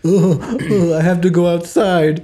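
high, loud whiny complaint; whining. Retold or imitated. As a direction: whine it like a sulky kid. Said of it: "which is whining".

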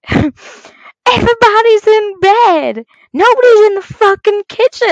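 high, loud, exasperated mimicry; imitated shouting back. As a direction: mimic an exasperated partner yelling. Partner shouts back in retold quarrel.

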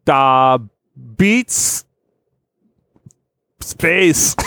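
high, slow hammed-up Russian accent; mock Russian accent. Host playing a Russian cosmonaut in the game, hammed-up Russian accent. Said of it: "each turn into Russian cosmonauts".

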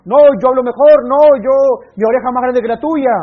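mocking men who compare cars and clothes; mocking braggarts. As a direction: ham up a show-off.

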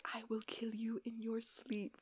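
whispered, menacing, into his ear; threatening whisper. Retold or imitated. Damon threatening John close to his ear, whispered. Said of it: "goes up to his ear and he's like".

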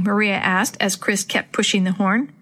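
plain reading voice

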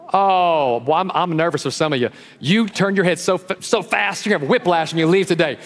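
usual speaking voice

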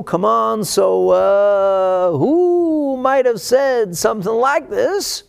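slow, high Southern drawl, put on. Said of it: "Use a southern drawl".

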